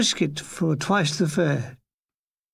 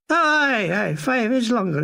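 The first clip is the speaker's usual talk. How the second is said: Scottish agreement; 4 semitones higher than usual. Retold or imitated. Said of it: "he agreed".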